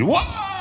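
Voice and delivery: high drawn-out "wah"; imitated crowd cheer. Congregation stands up amazed, imitated high cheer.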